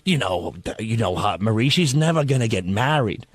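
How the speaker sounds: low, softened confiding waitress. Retold or imitated. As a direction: lightly imitate a waitress breaking it gently. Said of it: "waitress goes".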